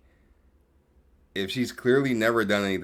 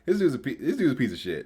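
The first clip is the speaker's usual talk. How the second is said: ordinary talk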